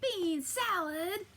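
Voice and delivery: slow, high outraged question; disgusted cry. Acted. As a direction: recoil at the salad. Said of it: "the giant cried".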